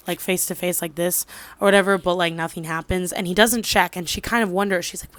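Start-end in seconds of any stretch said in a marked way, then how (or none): none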